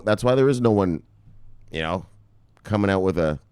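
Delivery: ordinary delivery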